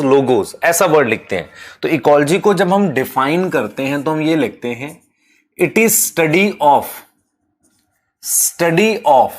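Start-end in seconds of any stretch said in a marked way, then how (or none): 5.6-9.3 very slow, measured dictating with long gaps; slow dictation. Real, not acted. Teacher dictating a definition to students, slow dictation. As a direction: dictate it slowly for note-taking.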